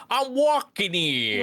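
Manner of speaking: high, drawn-out mock New York accent. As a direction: holler it in exaggerated New York, slowly. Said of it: "now that you said New York".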